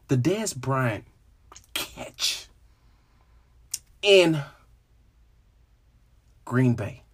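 low and soft with long pauses between words; pained, halting. Real, not acted. Cowboys fan naming the Dez Bryant catch, halting and pained. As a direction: barely get the painful memory out, piece by piece.

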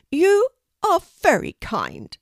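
high, loud, slow bird voice; grateful raven. Acted. Harris thanks Kelty for the rescue, slow grateful voice. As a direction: say it deliberately, starting high.

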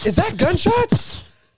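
high, drawn-out startled cry; startled question. Retold or imitated. Telling friends about hearing the shooting, startled. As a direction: re-enact the alarmed realization. Acting out startled reaction in story.

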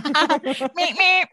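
high beeping car horn; car horn noise. Acted. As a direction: beep like an old car horn. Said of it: "That's the car horn".

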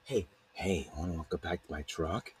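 low, hushed sly invitation; imitated come-on. Man inviting someone to his truck, hushed imitation. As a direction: drop low and soft, confidential. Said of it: "He's like".

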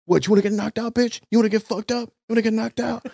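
high, aggressive taunting challenge; retold threat. Jersey Shore guy threatening the comedian, high and aggressive. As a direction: push him to fight.

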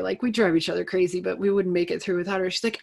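ordinary talk

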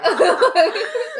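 loud, high burst of laughter. Real, not acted. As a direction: crack up at your own slip. Laughing at own misspoken word.

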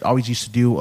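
usual speaking voice

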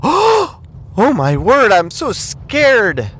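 high, slow, hammed-up frightened voice; mock scared gasp. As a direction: squeal it like a terrified fan. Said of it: "put your hands in front of your mouth and go".

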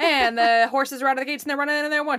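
fast horse race caller; race announcer. Pretend. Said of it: "in my announcer voice I just did".

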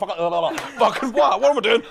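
high, loud, confused swearing; flustered mum. Retold or imitated. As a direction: mum panicking, not knowing what she did. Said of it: "And she's like".

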